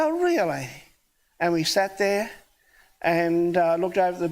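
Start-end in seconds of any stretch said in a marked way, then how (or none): 0.0-0.7 much higher, rising "really"; surprised. Retold or imitated. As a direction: genuine pleased surprise. Surprised at baptism news.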